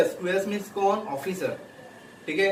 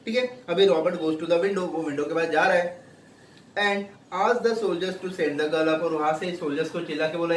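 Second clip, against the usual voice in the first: ordinary delivery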